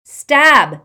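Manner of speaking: loud, high shout. Acted. Harry shouts at Ron to stab the locket. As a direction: yell the order with all you have. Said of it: "shouted Harry".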